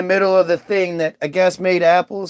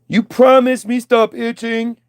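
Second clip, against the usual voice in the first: dim Eve; 4 semitones higher than usual. Retold or imitated. Eve's broken talk.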